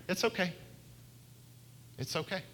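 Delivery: gentle reassurance. Pretend. Pastor voicing God comforting his own worried self, softly and gently. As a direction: soothe it like comforting a child. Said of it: "I feel like God is saying".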